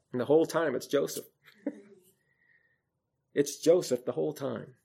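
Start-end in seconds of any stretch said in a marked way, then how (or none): none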